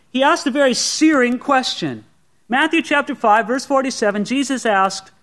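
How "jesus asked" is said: normal talking voice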